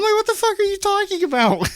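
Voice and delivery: high-pitched incredulous comeback; mock-outraged squeal of disbelief; retold indignant protest. Retold indignant reply to accusers.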